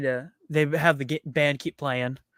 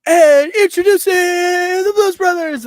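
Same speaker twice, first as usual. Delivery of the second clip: imitating an excited emcee at full volume; hyped stage announcer; 10 dB louder than usual. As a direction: belt out a big showman's introduction. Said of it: "wave up to Curtis, and he's like".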